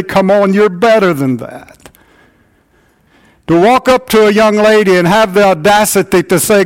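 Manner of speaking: rally the men from the pulpit; preaching at full force. Acted. Pastor urging the men of the church, preaching at full force.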